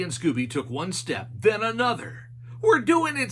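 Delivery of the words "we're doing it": high goofy Shaggy voice. A narrator's reading. Shaggy asking Scooby about a noise, high and goofy. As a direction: ask like a nervous cartoon slacker. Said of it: "said Shaggy".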